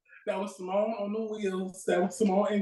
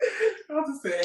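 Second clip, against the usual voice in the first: laughing; 7 semitones higher, slower than usual. Real, not acted. Laughing after S1's story.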